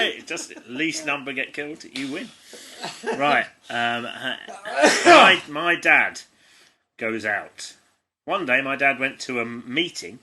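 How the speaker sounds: ordinary delivery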